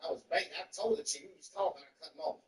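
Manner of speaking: usual speaking voice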